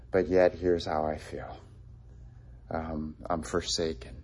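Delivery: low, quiet admission of abandonment; understated forsaken. Acted. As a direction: sink softly into feeling forsaken. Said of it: "and he feels forsaken".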